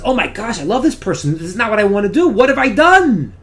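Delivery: the shock of seeing what he did; remorseful realization. Retold or imitated. Husband realizes his wrong.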